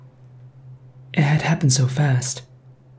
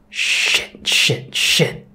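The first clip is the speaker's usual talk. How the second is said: muttered curse; much slower than usual. A narrator's reading. Driver shaken after hitting something, muttering. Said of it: "the driver muttered".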